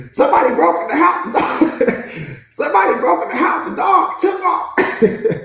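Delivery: loud, laughing through the telling; cracking up. Real, not acted. Telling how the guard dog fled, cracking up. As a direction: barely get it out for laughing.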